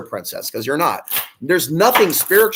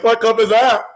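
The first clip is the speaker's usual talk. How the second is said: mock clueless woman; 5 semitones higher, 7 dB louder than usual. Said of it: "She's like".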